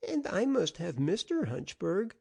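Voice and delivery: put-on high, eager little boy's chirp; bright child insisting on his game. A narrator's reading. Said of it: "chirped Hamilton".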